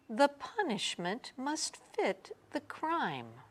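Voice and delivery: calm stern witch. A narrator's reading. Witch speaking calmly, sternly.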